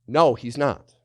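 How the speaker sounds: ordinary delivery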